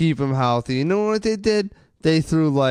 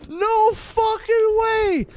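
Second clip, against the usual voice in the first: much higher, slow, drawn-out outraged yell; disbelieving yell; much slower than usual. Real, not acted. Fan venting about silent players, slow high incredulous yell. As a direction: stretch it out in total disbelief.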